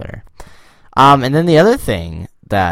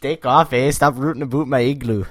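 higher, hammed-up Canadian accent; mock Canadian accent; 5 semitones higher than usual. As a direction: play an indignant cartoon Canadian. Joking stereotyped Canadian retort.

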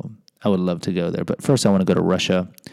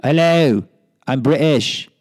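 put-on raised, louder Cockney-ish British accent; 5 semitones higher, 5 dB louder, slower than usual. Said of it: "I kinda have a little British".

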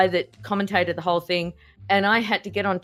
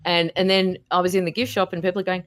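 ordinary delivery

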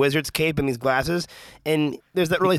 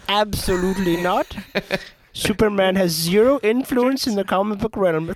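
mock snooty voice; 5 semitones higher than usual. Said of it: "You're like".

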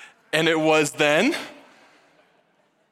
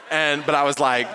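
usual speaking voice

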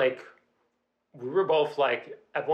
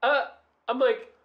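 ordinary talk, nothing marked

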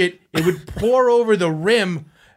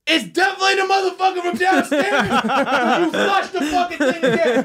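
high, laughing mock shout; storyteller acts out the upstairs dude yelling through the door; imitating the dude; 11 semitones higher than usual. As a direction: yell it through laughter like an annoyed neighbor. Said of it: "And then the dude go".

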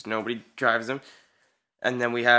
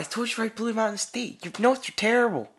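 mock scolding; 11 semitones higher than usual. Mock scolding friend's notes.

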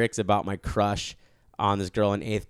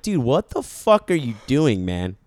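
scolding younger self; 6 semitones higher, 5 dB louder than usual. Pretend. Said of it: "I'd be like".

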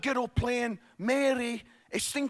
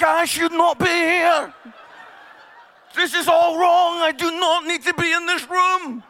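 loud, higher, laughing panic; panicked inner voice; 6 semitones higher, 9 dB louder than usual. Retold or imitated. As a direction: blurt it out through laughter. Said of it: "He's like".

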